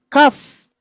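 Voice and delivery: 「kaf」を高く大きく; kaf contrast. Shown as a demonstration. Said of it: "hati-hati tertukar dengan".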